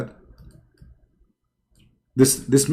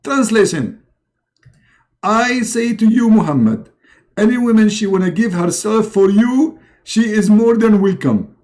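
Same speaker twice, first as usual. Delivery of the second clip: high, forceful proclamation; declaiming revelation translator; 8 semitones higher, 4 dB louder than usual. Acted. Muhammad translates Allah's message, high and declaiming. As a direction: proclaim it in a raised put-on voice.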